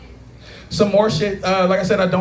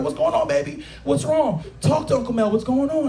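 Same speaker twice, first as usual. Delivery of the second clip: beaming down; 6 semitones higher than usual. Retold or imitated. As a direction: coax a child gently. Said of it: "I kinda beamed down".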